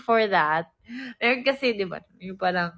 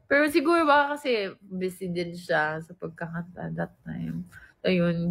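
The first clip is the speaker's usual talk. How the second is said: usual speaking voice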